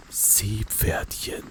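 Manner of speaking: ordinary talk, nothing marked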